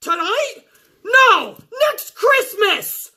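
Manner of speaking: high voice, slow at the end; mock referee question. Referee asks when she cashes in, high and slow. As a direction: drawl the sarcastic "next Christmas". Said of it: "Mike Chioda's like".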